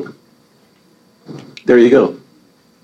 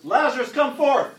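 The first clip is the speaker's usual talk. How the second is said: high called-out command; mock scream; 11 semitones higher, 11 dB quieter than usual. Preacher calling Lazarus out of the tomb, high and ringing. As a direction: call the dead man out of the tomb. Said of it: "He screamed".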